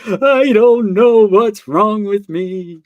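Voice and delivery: replaying his own crying in therapy, drawn out; retold bawling. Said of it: "I'm just bawling and I'm like".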